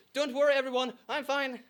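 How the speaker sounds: ordinary talk, nothing marked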